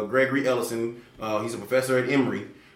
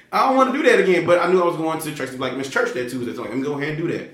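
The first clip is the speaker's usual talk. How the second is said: usual speaking voice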